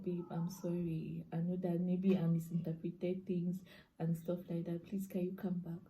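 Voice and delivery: soft, subdued pleading; retold meek apology. Girlfriend texting boyfriend to come back, soft retold apology. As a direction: replay a small, contrite plea.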